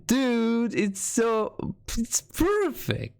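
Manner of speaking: high, rising gush drawn out on perfect; gushing excitement. Real, not acted. Streamer gushing to chat over the emote, high and excited. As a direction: swoon over how perfect it is.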